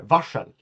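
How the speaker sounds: varsel with rs said as sh, "vashel"; varsel, rs as a retroflex sh; Swedish rs sound. Shown as a demonstration. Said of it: "We pronounce this like".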